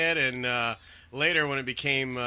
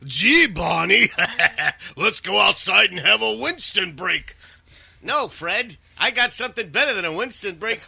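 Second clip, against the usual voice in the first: higher, louder goofy voices broken by laughter; Fred and his pal sharing a pipe; cartoon Flintstones voices; 7 semitones higher, 7 dB louder than usual. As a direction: ham up two cartoon buddies, laughing. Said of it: "Fred Flintstone".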